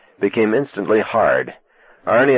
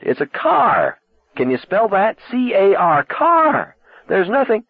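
high, mocking spell-out, much higher; exasperated; 12 semitones higher, slower than usual. A narrator's reading. Arnie scoffing at Lee over the phone, exasperated. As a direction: spell it out like she's a child.

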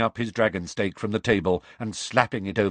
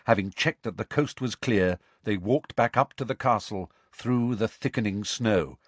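usual speaking voice

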